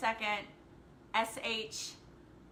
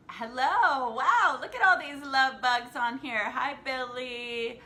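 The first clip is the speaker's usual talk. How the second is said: wowed and gushing, louder and lifted; excited greeting; 3 semitones higher, 8 dB louder than usual. Real, not acted. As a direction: greet arriving viewers with a big burst of excitement. Greeting viewers joining a livestream.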